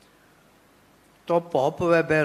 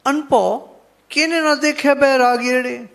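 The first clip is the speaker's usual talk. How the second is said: raised, louder chant-like recitation of the verse; scripture recitation; 7 semitones higher, 5 dB louder than usual. Shown as a demonstration. Preacher reciting Bhakt Ji's verse to the sadhu, raised and chanting. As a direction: lift the holy line up high and ring it out. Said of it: "Bhagat ਜੀ ਕਹਿੰਦੇ ਆ".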